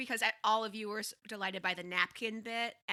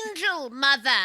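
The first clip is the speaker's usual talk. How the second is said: loud, higher call in character; calling out to mother; 5 semitones higher, 12 dB louder than usual. Acted. Chatty daughter repeating herself to her mother, loud call. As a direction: shout it across as the chatty daughter.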